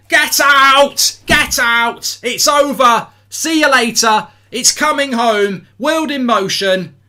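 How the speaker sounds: loud, high triumphant shouting; grito de celebración. Real, not acted. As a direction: roar each phrase at the beaten rivals. Celebrating England's winning goal.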